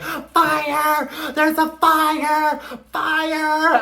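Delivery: high-pitched, loud mock shouting; mimicked alarm cry. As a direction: holler a warning in a put-on high voice. Carrie calls out a fire alert.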